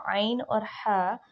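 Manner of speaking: slow आइन और हा from the middle of the throat; mid-throat letters. Shown as a demonstration. Teacher introducing ain and ha, slow and deliberate. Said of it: "दरमियान वाले हिस्से से".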